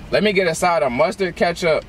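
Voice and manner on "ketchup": usual speaking voice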